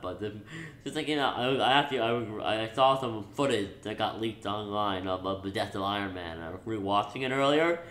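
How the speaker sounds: usual speaking voice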